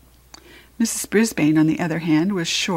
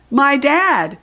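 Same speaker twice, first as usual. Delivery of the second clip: put-on high child's call-out, moderately raised; shouting out; 6 semitones higher, 4 dB louder than usual. A narrator's reading. Boy calls out an answer in class, mild high shout. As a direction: call it out across the classroom, pitched up. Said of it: "A voice shouted out".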